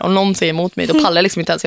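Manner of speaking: normal talking voice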